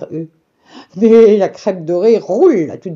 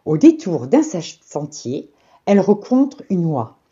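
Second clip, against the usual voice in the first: ordinary delivery, nothing marked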